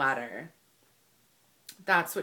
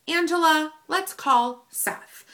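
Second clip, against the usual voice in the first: higher, slowing mock inner voice; playful self-talk; 7 semitones higher, 5 dB louder, slower than usual. Angela talking herself into calling corporate, playful self-talk. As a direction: address yourself in a high, drawn-out voice. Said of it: "Angela thought to herself".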